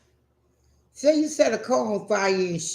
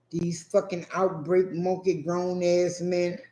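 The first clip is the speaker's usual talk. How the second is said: normal talking voice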